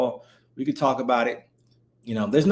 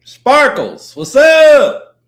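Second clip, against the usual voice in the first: loud excited greeting; 10 semitones higher, 10 dB louder, much slower than usual. Real, not acted. Greeting a viewer on stream.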